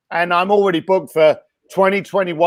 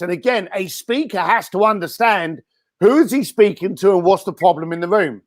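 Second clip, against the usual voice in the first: usual speaking voice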